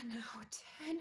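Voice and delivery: breathy whisper; whispered count. Acted. Molly counting her breaths in a whisper. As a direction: whisper the count to steady yourself.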